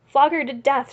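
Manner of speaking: roaring at the crowd; shout. A narrator's reading. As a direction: bellow it like a drunk bully. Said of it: "shouted Mikolka".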